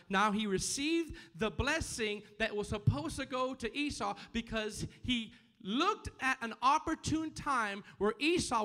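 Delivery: loud, high, emphatic preacher; full-force preaching. Real, not acted. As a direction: drive each phrase home to the congregation.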